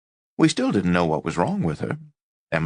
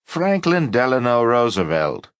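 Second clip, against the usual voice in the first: mocking; 5 semitones higher, 4 dB louder than usual. A narrator's reading. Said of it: "and cackled".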